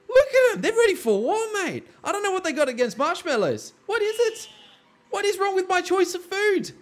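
high, squeaky indignant rant at the soldiers; mock indignant rant. Gamer ranting at cowering soldiers, mock indignant high voice. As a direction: play a wounded marshmallow lover.

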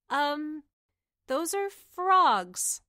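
put-on serving girl correcting the governor; awkward correction. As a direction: tactfully point out the frogs.

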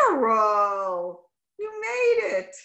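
overjoyed, choked-up welcome; emotional greeting. Real, not acted. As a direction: pitch soaring, near tears with joy. Teacher greets arriving student.

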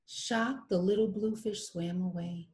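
usual speaking voice

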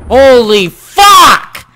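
slow, loud, high furious shout; angry shout. Real, not acted. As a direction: blow up at the game. Game rage outburst.

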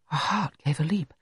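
usual speaking voice

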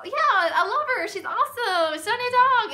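high-pitched gush, acting out excitement; excited gushing. Going on about her new dog, excited gush. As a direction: gush like a proud new owner. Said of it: "shows how excited I am".

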